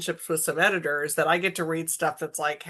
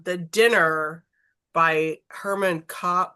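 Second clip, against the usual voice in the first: ordinary talk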